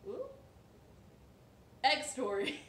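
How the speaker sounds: usual speaking voice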